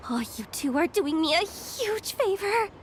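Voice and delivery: usual speaking voice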